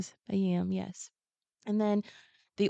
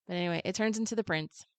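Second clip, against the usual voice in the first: normal talking voice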